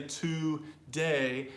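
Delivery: today split into two full syllables, "too... day"; deliberate "too", then "day"; slowly broken down. Shown as a demonstration. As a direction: break today apart syllable by syllable. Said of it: "how you would slowly break it down".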